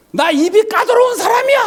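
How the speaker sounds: very high, hammed-up indignant customer yell; mock furious shout. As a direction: shout it like a self-important angry diner. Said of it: "굉장히 화를 내더래요". (까다로운 손님 흉내로 버럭 화내듯)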